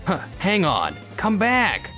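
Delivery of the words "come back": high, pleading call; desperate, pitched-up plea; calling after someone. Acted. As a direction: call them back as they walk away. Calls after departing girlfriend.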